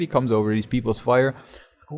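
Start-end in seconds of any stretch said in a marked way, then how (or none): none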